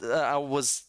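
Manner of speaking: ordinary talk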